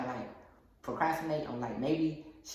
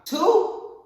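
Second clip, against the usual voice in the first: high, loud shouted count; loud announcement; 12 dB louder than usual. Real, not acted. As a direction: call out the number emphatically. Announcing the lessons at full voice.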